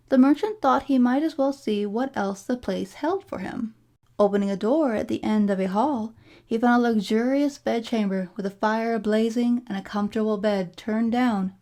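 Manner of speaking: ordinary delivery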